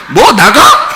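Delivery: imitated indignant kid's comeback; mock defiant retort. Today's kid answering the parent, mock defiance. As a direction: fire it back like an offended kid.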